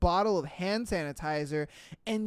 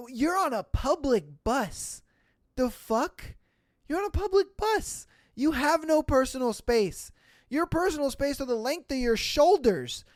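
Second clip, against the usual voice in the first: high-pitched, exasperated disbelief; incredulous, voice climbing; incredulous exasperation; 6 semitones higher than usual. Real, not acted. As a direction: argue back in amused disbelief. Ranting at story's woman.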